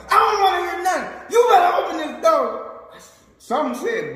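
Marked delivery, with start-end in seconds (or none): 0.1-2.5 fast, higher, louder furious yell; angry mom. Retold or imitated. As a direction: bark orders like a mad mother. Mom yelling at son.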